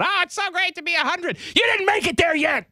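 high, mocking centenarian voice; mock old lady. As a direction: sneer like a bragging hundred-year-old. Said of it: "talking about".

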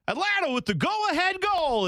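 very high, excited play-by-play imitation; mock a commentator crying out a goal; hammed-up broadcast call; announcer's goal call. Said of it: "where I thought he was saying".

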